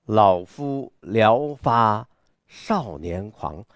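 拖长字音朗诵，开头有力，后面收低; 少年般的狂放. Shown as a demonstration. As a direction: recite slowly with bold, youthful abandon. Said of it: "具有着像少年一样". (狂放自信、慢速吟诵)

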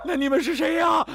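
high, loud fearful shout; scared shout. Acted. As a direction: yell it out of fear. Frightened old man demands.

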